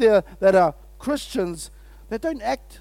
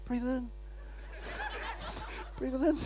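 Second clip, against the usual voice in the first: much softer, near whisper, with a chuckle; under your breath; 3 semitones higher, 15 dB quieter than usual. Shown as a demonstration. Pastor showing how to say it quietly at work, under your breath. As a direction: breathe the words so no one hears. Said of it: "even in, under your breath".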